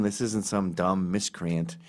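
normal talking voice

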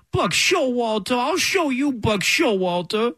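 high, slow tantrum whine; whiny baby voice. Retold or imitated. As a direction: shake a tiny fist like a toddler. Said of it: "that's how they sound".

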